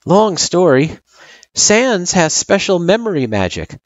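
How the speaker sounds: put-on high-pitched child character, explaining; kid explaining. A narrator's reading.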